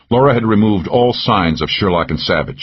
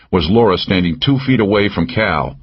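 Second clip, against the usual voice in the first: plain reading voice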